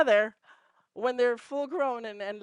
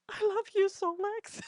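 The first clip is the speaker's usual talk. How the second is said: tender mom voice; 11 semitones higher than usual. Retold or imitated. Mom to her son after his hug, high tender coo. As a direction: coo it lovingly to your grown son.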